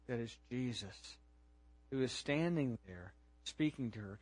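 low, very soft preaching with long pauses; hushed storytelling. Real, not acted. Preacher telling of Mary recognizing Jesus, hushed and low. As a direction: let the realization dawn in a hush.

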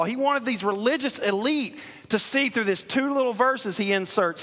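impassioned sermon delivery, voicing Jesus; fiery preaching. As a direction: drive the point home from the pulpit. Preaching at full force.

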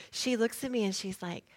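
ordinary talk, nothing marked

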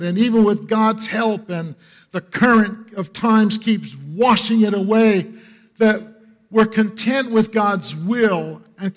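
loud, high, ringing sermon climax; a preacher pouring it on the congregation; full-force preaching. Acted. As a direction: preach it out with fire.

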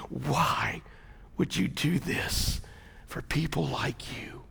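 hushed, barely voiced temptation; Satan whispering. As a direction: murmur it softly, as if into his ear. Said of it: "he whispers in his ear".

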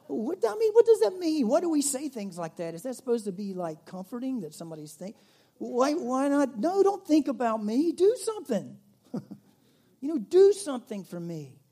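high, loud and exasperated; exasperated outburst. Real, not acted. Preacher railing at empty comfort, loud and exasperated. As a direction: let the frustration burst out.

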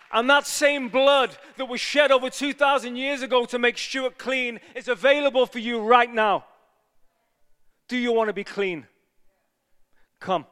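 loud, high graveside preaching; preaching at full force. Acted. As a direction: preach it to win the crowd.

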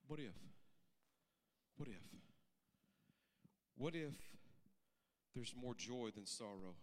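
soft, low, pausing between questions; quiet reflective close. Acted. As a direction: let each question settle gently.

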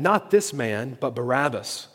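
ordinary delivery

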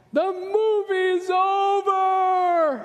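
high, long sung-out wail, slow and loud; sing-wail it like a distraught kid; daughter wailing back. Retold or imitated. Imitating his daughter wailing from the other room, high, loud and drawn out. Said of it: "And she calls back".

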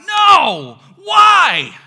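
loud, high anguished shout to heaven; anguished cry. Acted. Believer crying out to God after a tragedy, loud anguished shout. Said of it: "we cry out to God".

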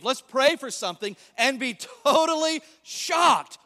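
loud, punchy preacher shout; shouted with mock disbelief; emphatic shout. Real, not acted. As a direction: hammer it home to the congregation. Preacher mocking unbelief.

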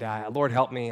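usual speaking voice

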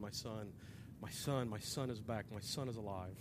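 soft, low imitation of an overcome mother; hushed, understated tearful relief; imagined sobbing mother. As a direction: murmur it over and over, lightly acted. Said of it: "I just picture her just sobbing".

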